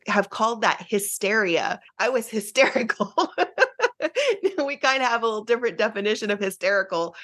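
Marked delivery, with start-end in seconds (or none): 3.2-4.5 a sudden high laugh at herself; high burst of laughter. Real, not acted. Guest laughs at her own hysterical label, high burst of laughter.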